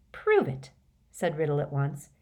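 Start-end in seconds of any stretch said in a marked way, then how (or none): 0.1-0.7 sharp, high demand; commanding tone. Acted. Riddle demanding proof from Dumbledore, commanding. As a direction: order him to prove it. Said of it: "in the same commanding tone".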